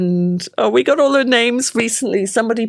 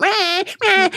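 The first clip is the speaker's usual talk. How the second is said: baby whimper imitation; 10 semitones higher than usual. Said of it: "baby fussing imitated".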